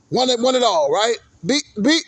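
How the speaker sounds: ordinary talk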